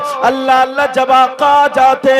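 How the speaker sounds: loud, driving sermon at full force; fiery preaching. Acted. Preacher tells the crowd of the Prophet's kindness, fiery full-force preaching. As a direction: preach it out with fervour.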